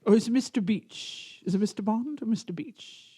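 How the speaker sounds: put-on British villain voice, soft; mock British accent. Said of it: "I make him talk British".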